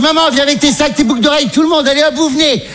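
high, eager calling out; excited calling out. Retold or imitated. Son calls his mother and her bags to board, high eager call. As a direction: call her over, thrilled.

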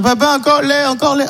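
put-on toddler asking. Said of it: "pour lui".

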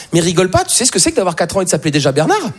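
usual speaking voice

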